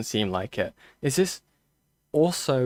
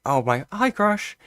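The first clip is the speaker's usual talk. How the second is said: excited greeting; 8 semitones higher, 4 dB louder than usual. Real, not acted. Greets Crash arriving.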